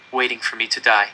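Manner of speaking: ordinary delivery, nothing marked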